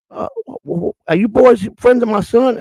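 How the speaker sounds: put-on high, slow Southern old-lady voice. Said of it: "a nice old lady answered the door with a southern accent".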